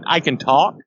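usual speaking voice

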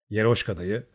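ordinary delivery, nothing marked

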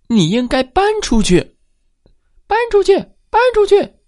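霸道地叫他搬出去; bossy mother mouse. A narrator's reading. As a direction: order him out like a pushy mouse. Mother mouse demands eviction.

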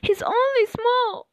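high-pitched protective plea; lifted storybook cry of protest; Dorothy crying out. A narrator's reading. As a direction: plead for the little dog. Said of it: "Dorothy cries".

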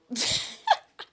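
high, bright burst of giggling; high giggle. Real, not acted. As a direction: giggle at your own story.

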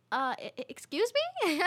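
stammer, then shoot high on excuse me; stunned disbelief, pitch jumping high; answering the driver's accusation in disbelief; shocked excuse me. Retold or imitated. Accused of trying to rob.

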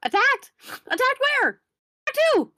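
high, loud panicked squeal; panicked page. Acted. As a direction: shriek it like a flustered page.

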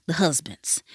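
"husbands" whispered, soft and low; whispering. Real, not acted. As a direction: drop to a secret whisper on "the husbands". Repeated as a sly aside.

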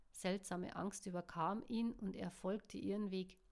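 usual speaking voice

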